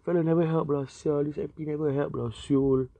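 lightly hammed-up Singlish gripe; Singlish complaint. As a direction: pitch it up like a fed-up local grumbling about the MP. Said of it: "Never once have I said that".

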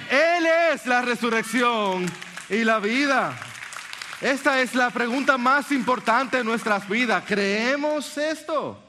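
slow, soaring sermon delivery; fervent preaching. Acted. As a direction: preach it at full force to the congregation. Preaching climax continues.

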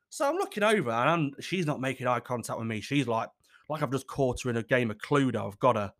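normal talking voice

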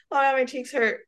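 ordinary delivery

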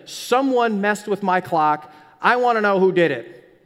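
loud, slow, stern father demanding answers; a mock-stern dad laying down the law; angry dad. Dad in the kitchen demanding who touched his clock, loud and stern. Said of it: "and he is mad".

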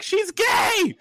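shrieking high mock alarm, far above own voice; high mock panic in mom's voice; mock panicked mom. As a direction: squeal it like a horrified mother. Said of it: "my mom was like".

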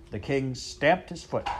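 plain reading voice

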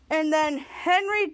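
ordinary talk, nothing marked

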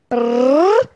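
rolled lips trill, rising; vocal drum roll before the reveal. Pretend. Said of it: "it's my drum roll".

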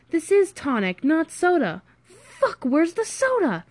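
high-pitched, agitated cursing; frantic exasperation. Acted. Friend hunting for the soda, frantic.

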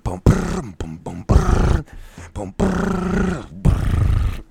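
high, loud mouth blast beat; loud vocal blast beat; vocal drumming. Retold or imitated. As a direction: play the drums with your mouth. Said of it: "and I was like".

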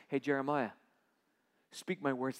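low, soft call; voice of God. Acted. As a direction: call him gently from below. God calling again.

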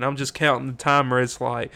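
ordinary talk

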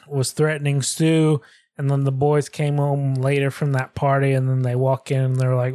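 normal talking voice

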